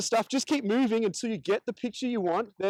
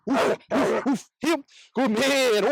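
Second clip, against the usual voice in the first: pumped-up dog cue; 3 semitones higher, much slower than usual. Shown as a demonstration. Trainer hyping up his dog before heeling. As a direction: pump the dog up with big energy. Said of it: "You can really pump 'em up".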